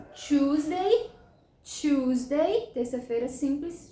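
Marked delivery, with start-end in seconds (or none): 0.1-2.7 "Tuesday" as CHOOZ-day, higher with rising end; contrast, no R. Shown as a demonstration. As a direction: lift "Tuesday", pure OO and no R. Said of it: "olha a diferença".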